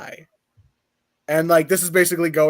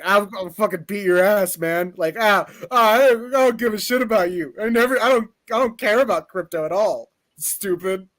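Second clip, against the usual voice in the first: higher-pitched mock tough talk; defensive bluster; 5 semitones higher than usual. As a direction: bluster like a kid denying he cares. Acted quarrel over a dog.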